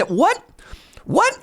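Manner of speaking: high-pitched outraged what; shocked disbelief. Real, not acted. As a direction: squeal in disbelief at everyone.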